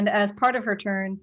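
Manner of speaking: ordinary talk, nothing marked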